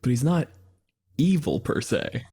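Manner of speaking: ordinary delivery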